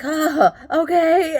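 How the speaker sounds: high, loud, drawn-out mock okay; pained acceptance. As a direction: stretch it out as if hiding a burning mouth. Acting reply with mouth burning.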